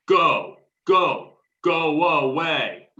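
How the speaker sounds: loud yells, last words drawn out slowly; shouting. Real, not acted. Protester yelling at the riot police to leave, loud shouting. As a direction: drive them off with a shout.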